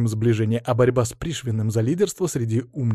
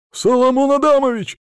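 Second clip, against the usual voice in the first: high, loud alarmed cry; exclaiming; 13 semitones higher, 6 dB louder than usual. Acted. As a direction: call out in alarm. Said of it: "воскликнул Платон".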